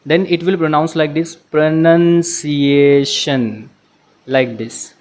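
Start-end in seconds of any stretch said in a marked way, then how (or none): 1.4-3.7 very slow, syllables stretched right out; slow playback. Shown as a demonstration. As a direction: draw out every syllable like slowed-down audio. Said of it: "to make it slow for you".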